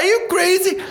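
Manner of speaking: high-pitched incredulous outburst. Acted. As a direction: exclaim it in comic disbelief. Incredulous retort to the swimmers.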